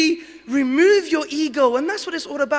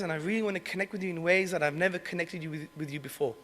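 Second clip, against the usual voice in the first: tender apology; 6 semitones lower, 6 dB quieter than usual. Retold or imitated. Emotional apology call to father.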